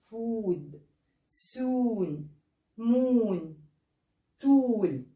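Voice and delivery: each word said with a long oo vowel. Shown as a demonstration. Teacher modelling long o words for students. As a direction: draw out the vowel in every word. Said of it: "تاني معايا ويا ريت نقرا مع بعض".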